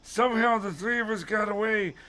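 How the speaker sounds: high, urgent character voice; high, hammed-up panicked old man; Mister Dawes. A narrator's reading. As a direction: put on a high voice, shriek the hurry. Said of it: "Mister Dawes said".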